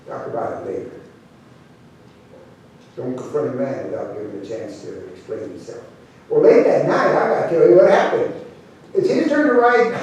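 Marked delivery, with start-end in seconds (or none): 0.1-5.8 low, hushed, measured voice; trail boss's calm order. Acted. Trail boss holding the men back, low and quiet. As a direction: say it low and soft, in quiet authority.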